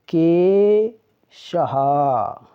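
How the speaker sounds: के शहा very slow, vowels held; teacher slowly sounding out के शहा; pronunciation demo. Shown as a demonstration. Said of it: "ए की मात्रा का उच्चारण करते हैं".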